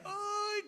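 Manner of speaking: high strained little groan of aching knees; mock groan getting up. Preacher imitating an elderly sister standing up, mock groan. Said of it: "la hermana se paraba y decía".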